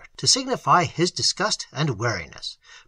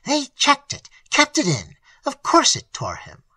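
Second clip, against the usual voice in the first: higher and sharper, a flash of anger; impatient, half angry; 12 semitones higher, 5 dB louder than usual. A narrator's reading. The pastor, half angry, tells the tutor about the doctors, higher. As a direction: bite out the words impatiently. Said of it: "impatience, half anger, in his tone".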